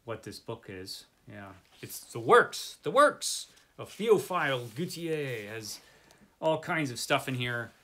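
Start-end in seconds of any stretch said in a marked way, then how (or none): 1.8-5.5 French accent. Shown as a demonstration. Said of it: "my French accent isn't so good".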